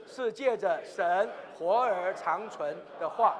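slow, measured recitation; leading scripture reading. Real, not acted. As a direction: read it out slowly for all to follow. Leads congregation reading scripture. (缓慢有力地领读经文)

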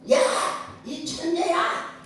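high, drawn-out mock holler; retold child's shout. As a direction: call slowly up to the mountain in a high child's yell. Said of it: "하고 소리를 지르니까".

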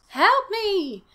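high, loud mock wail; hammed-up panicked plea; cry for help. A narrator's reading. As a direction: squeal for rescue, drawn out. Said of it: "he managed to cry".

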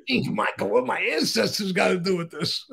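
high puzzled voice, laughing. Retold or imitated. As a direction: laugh through the question. Jackie's puzzled reply, laughing.

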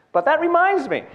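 high, loud punchline delivery. Shown as a demonstration. Comedian showing the line she wants on her tombstone, high and loud. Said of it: "I think that should be on my tombstone".

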